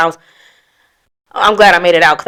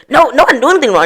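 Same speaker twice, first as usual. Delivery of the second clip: high-pitched mock protest; narcissist's denial; 6 semitones higher, much faster than usual. Narcissist cutting off the accuser, high mock denial. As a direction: mimic someone refusing all blame. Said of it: "narcissistic person just like".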